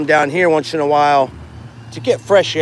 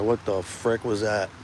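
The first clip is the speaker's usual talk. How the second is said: ordinary talk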